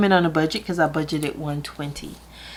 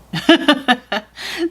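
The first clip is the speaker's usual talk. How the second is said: bright high burst of laughter; real laugh at yourself; 11 semitones higher, 5 dB louder than usual. Real, not acted. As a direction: laugh at your own joke. Laughs at own joke to kids.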